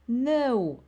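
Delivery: sharp high loud cry; shout. A narrator's reading. Boy shouting at his brother and father, loud cry. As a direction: cry out to stop them. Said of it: "I shouted".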